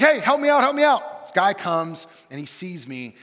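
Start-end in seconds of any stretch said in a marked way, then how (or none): 0.0-1.2 loud, high, urgent call for help; frantic plea. Retold or imitated. Locked-out student pounds on door, frantic and loud. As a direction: beg through the door, panicked. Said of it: "I knock on the door frantically".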